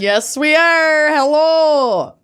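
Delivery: put-on high, drawn-out Minnesotan accent. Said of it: "unleashing their worst Minnesotan accents".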